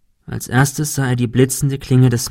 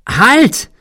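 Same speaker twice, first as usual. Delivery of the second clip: very high, loud shrill cry; shrill scream; 12 semitones higher, 7 dB louder than usual. A narrator's reading. Thomas screams at Cecil to stop, shrill loud scream. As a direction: scream it in sudden alarm. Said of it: "schrie er schrill".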